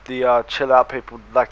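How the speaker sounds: usual speaking voice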